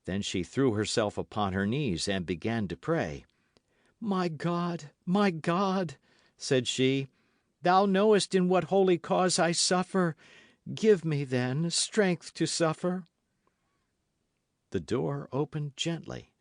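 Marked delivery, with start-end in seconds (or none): 4.0-13.1 higher, pleading woman's prayer; a touch of a pious martyr; fervent prayer. Acted. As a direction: beg heaven with feigned piety. Milady praying aloud.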